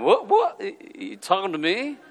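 a light De Niro, high tough-guy challenge; hint of a tough New York guy; De Niro impression. Pastor mocking his own anger, lightly De Niro tough guy. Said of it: "Robert De Niro".

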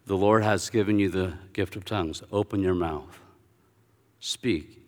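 low, soft, unhurried prompting; calm instruction. Retold or imitated. Minister praying over student for tongues, calm instruction. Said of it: "then he gets a little irritated".

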